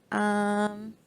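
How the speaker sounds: plain reading voice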